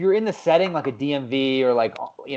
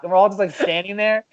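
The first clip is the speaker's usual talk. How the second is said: ordinary talk, nothing marked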